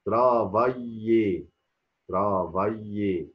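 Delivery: ترافايي very slowly, each syllable stretched; ترافايي with a y glide in place of l. Shown as a demonstration. Teacher models the word for learners, slow syllables. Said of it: "بنطقها أي".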